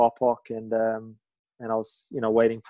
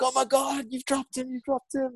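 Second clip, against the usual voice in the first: much higher, thrilled imitation of the crew; excited; 12 semitones higher than usual. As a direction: squeal the news like the crew did, pitched way up. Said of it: "they're like".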